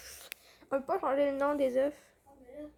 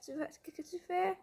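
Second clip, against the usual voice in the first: ordinary talk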